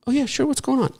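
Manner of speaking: high, soft accommodating reply; light, high-pitched, hushed willingness; obliging friend. Acted. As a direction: play the agreeable friend in a small high voice.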